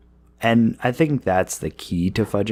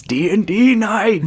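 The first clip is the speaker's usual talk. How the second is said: whiny family member; 13 semitones higher, 6 dB louder than usual. Retold or imitated. Voicing family member's complaint.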